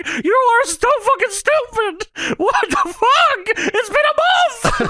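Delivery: very high mock wail; exasperated high-pitched whining, hammed up; Paul Bearer. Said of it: "and Paul Bearer says".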